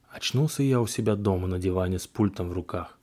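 ordinary delivery

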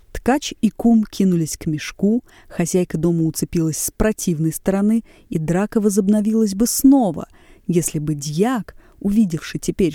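plain reading voice

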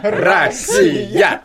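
high, loud laughing cheer; laughing shout. Pretend. Patriotic rallying cry, laughing.

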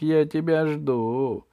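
drawn-out, slow witchy coaxing call; Baba Yaga calling. A narrator's reading. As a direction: lure the child over, stretching the vowels. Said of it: "закричала Баба Яга". (протяжный зов Бабы Яги)

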